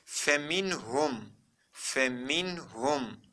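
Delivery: "Feminhum" slow, with a back U at the end; model reading. Shown as a demonstration. Teacher shows students how to read it, slow model reading. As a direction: stretch "Feminhum" syllable by syllable. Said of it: "nasıl okumamız gerekiyor?".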